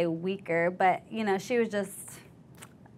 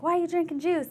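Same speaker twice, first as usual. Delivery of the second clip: sergeant yelling; 10 semitones higher than usual. Retold or imitated.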